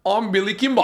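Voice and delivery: a gangster swallowing his own name; Billy Kimber impression. Competing with cohost in a say-off, mock gangster accent. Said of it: "Who says it better?".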